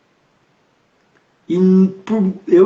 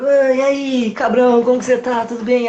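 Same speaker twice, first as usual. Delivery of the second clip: bright, cheerful hello to a buddy, pitched higher; friendly greeting, higher and a bit louder, ribbing a pal; friendly jokey greeting; 5 semitones higher, 3 dB louder than usual. Retold or imitated. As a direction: greet him like an old friend you're about to hug. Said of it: "Abraço, dou risada".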